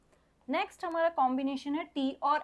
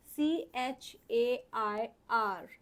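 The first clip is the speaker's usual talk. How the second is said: एस एच ए पी ई spelled very slowly; slow spelling; much slower than usual. Shown as a demonstration. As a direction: drag each letter out for the child. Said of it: "teacher spells letters slowly". (बहुत धीरे अक्षर बोलना)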